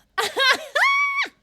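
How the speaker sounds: loud, high cackle; sudden real shriek of laughter; burst of laughter. Real, not acted. Bursts out laughing between lines.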